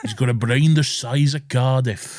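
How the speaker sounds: put-on broad Welsh accent, proud father; hammed-up Welsh dad bragging. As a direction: boast in a lilting Welsh voice. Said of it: "He's very Welsh".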